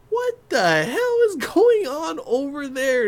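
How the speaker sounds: high, drawn-out incredulous outburst; mock shocked disbelief. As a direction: stretch it out slowly, high and baffled. Reacting to strange sounds heard.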